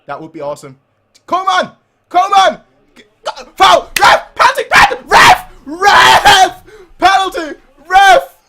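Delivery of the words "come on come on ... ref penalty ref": high, loud frantic shouting; screaming for a penalty. Real, not acted. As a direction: yell at the ref at full volume. Fan demanding a penalty.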